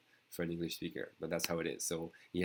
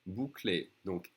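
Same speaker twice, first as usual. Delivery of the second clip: ordinary delivery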